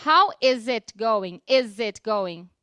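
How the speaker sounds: slow model "How is it going", z sound in "is"; ideal linked pronunciation. Shown as a demonstration. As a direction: draw out "is it" as a linked "izit". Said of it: "a pronúncia ideal seria".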